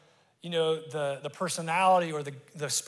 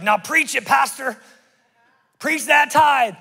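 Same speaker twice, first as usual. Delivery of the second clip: excited cheering; 10 semitones higher, 10 dB louder than usual. Retold or imitated. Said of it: "she got up and she's like".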